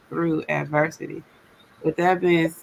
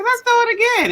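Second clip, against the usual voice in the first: excited; 6 dB louder than usual. Retold or imitated. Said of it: "I said".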